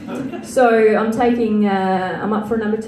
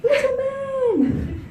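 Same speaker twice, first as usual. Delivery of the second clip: put-on high, slow, earnest little girl voice; hammed-up high child pleading; desperate little girl; 7 semitones higher than usual. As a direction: lecture her baby brother with mock desperation. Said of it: "the desperation in her voice".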